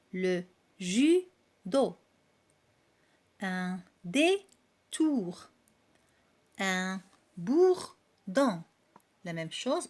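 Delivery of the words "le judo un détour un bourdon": very slow, each syllable drawn out; slow syllable reading. Shown as a demonstration. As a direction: stretch every syllable for the class. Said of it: "il y a deux syllabes". (lecture très lente, syllabe par syllabe)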